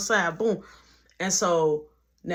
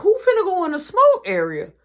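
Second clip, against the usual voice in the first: much higher, louder, keen question; eager call-out; 11 semitones higher, 8 dB louder than usual. Retold or imitated. Worker eagerly asking coworkers who's going on break.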